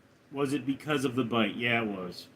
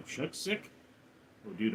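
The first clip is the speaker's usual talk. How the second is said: ordinary delivery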